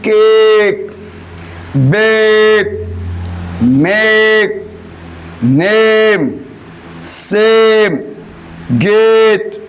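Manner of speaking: each word drawn out clearly for the class; teacher modelling words. Shown as a demonstration. Teacher reads example words aloud to class, carefully articulated. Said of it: "जैसे".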